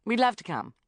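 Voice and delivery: loud, firm acceptance. A narrator's reading. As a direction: accept firmly, no arguing. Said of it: "said Sharon firmly".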